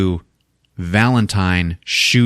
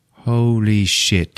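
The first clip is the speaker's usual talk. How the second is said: slow awe; much slower than usual. Retold or imitated. Slow awed realization.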